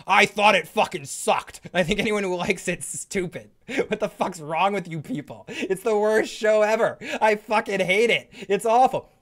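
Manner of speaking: high, mock-furious ranter; Joe. Fake guest trashing Westworld to the host, mock furious. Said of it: "rest of this show with Joe".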